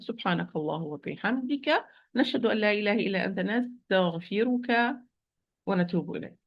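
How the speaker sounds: quick murmured closing supplication; prayer. Acted.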